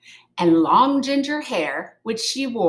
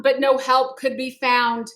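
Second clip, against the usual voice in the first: ordinary delivery, nothing marked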